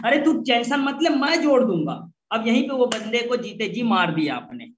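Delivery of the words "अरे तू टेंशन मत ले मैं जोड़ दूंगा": high, brash and a bit louder; brash overpromising. Shown as a demonstration. Wrong way to answer a worried prospect: brash, high, louder. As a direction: toss off a careless promise. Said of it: "इस तरह का objection आता है तो क्या बोलते हैं".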